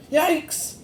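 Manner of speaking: sudden high yelp of fright; startled yelp. A narrator's reading. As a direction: jump with a squeal. Sudden scare by a rabbit.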